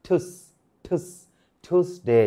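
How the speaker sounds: "ঠুস" as one aspirated syllable "thoos"; correct English pronunciation. Shown as a demonstration. Teacher drilling learners, "ঠুসডে" carefully pronounced "t-hoos-day". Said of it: "টুয়েসডে বলে আসলে কিছু নেই".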